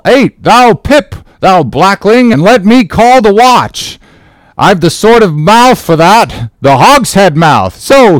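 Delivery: high, loud hogshead-mouth calling; bawling sailor. Acted. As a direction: roar the watch awake. Second sailor calls the watch.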